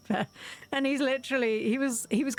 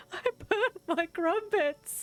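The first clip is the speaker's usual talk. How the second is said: higher, slow, sorry-for-himself whine; imitating a sulking friend, drawn out and high; mock sad whine; 9 semitones higher, much slower than usual. Streamer imitating her friend's sad face, slow high whine. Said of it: "sad face".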